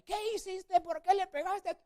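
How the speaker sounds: high, loud scolding; angry grandmother. Retold or imitated. Grandma interrogating the boy, high and loud. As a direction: demand answers like a furious abuela.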